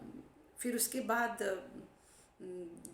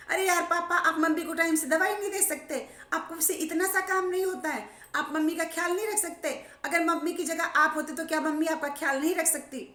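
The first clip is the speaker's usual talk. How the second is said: raised, complaining voice at papa; annoyed scolding; 6 semitones higher, 5 dB louder than usual. Retold or imitated. Grown children scolding their old father on the phone, annoyed.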